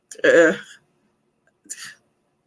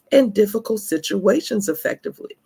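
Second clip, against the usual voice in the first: ordinary talk, nothing marked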